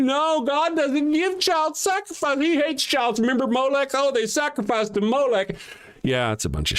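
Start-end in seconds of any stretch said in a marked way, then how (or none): 0.0-2.4 high, slow mocking voice of a believer. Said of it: "And you're like". 2.4-5.6 high put-on pious voice; mocking believer. Mock believer voice continues.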